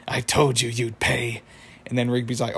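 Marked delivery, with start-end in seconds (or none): 0.1-1.4 slow, menacing put-on wizard voice. Wizard confronts Rigby, slow mock menace. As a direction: draw out the threat as the wizard. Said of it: "The wizard shows up. He's like".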